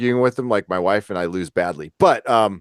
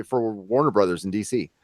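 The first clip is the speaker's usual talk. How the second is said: normal talking voice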